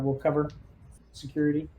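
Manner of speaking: ordinary delivery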